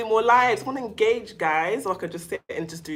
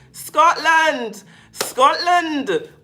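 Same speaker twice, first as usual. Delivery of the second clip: louder, higher mock Scottish accent; bad Scottish accent; 8 semitones higher, 7 dB louder, much slower than usual. Streamer shouting out a viewer's country in a terrible Scottish accent. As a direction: belt it out in a badly faked Scottish accent. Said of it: "That's the worst accent".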